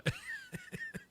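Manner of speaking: a squeaky high giggle; high laugh. Real, not acted. Host giggles high at the French Bulldogs joke. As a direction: burst into a high-pitched giggle.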